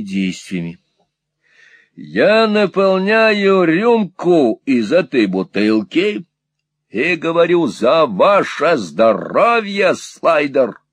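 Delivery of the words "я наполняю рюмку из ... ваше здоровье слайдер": slow, high, loud announcing; loud, demonstrative. A narrator's reading. Squeers demonstrates drinking to deaf Peg, loud and deliberate. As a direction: announce each step loudly and slowly.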